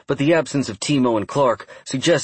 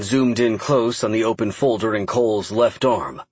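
usual speaking voice

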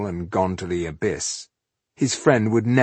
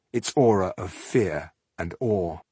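ordinary delivery

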